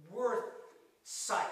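"worth sight" as two careful, separate, loud beats; "worth sight" pushed out with effort, a pause between; Old English pronunciation. Shown as a demonstration. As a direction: sound out "worth ... sight" slowly as an archaic word. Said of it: "old English word that I can only pronounce".

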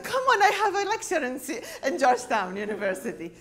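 high, loud comic exasperation; exasperated plea. Retold or imitated. Lecturer scolding her eyelash glue before a university talk, exasperated. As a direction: plead loudly, half laughing, at the top of the voice.

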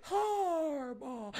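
soft, drawn-out mock-adoring call; angelic. Mocking fans fawning over their coach, angelic. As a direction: breathe out the name like a worshipper. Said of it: "in such an angelic way".

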